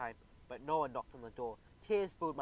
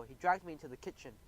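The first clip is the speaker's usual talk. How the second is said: ordinary delivery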